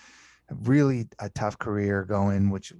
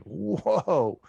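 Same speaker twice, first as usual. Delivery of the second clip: stunned whoa; 8 semitones higher than usual. Retold or imitated. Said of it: "And I'm like".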